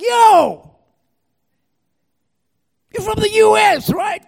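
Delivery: high-pitched excited shout; loud, high hail to a fellow countryman; calling out. Retold or imitated. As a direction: holler it across to a passing stranger. Calling out to a stranger.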